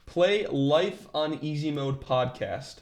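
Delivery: slowly spelling it out for Siri; each word clear and slow; slow and deliberate. Shown as a demonstration. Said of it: "Wait, one more time".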